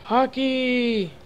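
high, drawn-out cheer; excited call. Real, not acted. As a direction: sing out the word high and long. Celebrating during the game.

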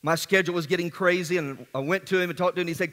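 ordinary talk, nothing marked

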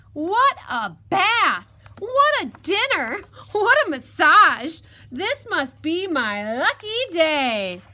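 high, loud, drawn-out exclamations; jubilant piglet. Acted. Piglet heads home after outwitting fox, jubilant. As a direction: whoop each line, stretching the words in glee.

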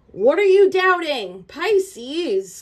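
loud, high and slow, pressing the question; urgent plea. Real, not acted. Reader pleading loudly with Pisces about doubting. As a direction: demand it with rising urgency.

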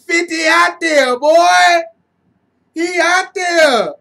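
loud, high, slow-ending yell; hype shout. Real, not acted. Fan yelling praise at a player, loud and high. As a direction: call him out with pride.

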